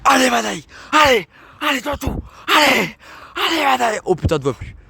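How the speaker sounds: shouting fitness coach countdown; sports coach. Pretend. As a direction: yell encouragement like a gym trainer. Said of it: "je suis coach sportif".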